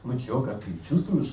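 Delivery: normal talking voice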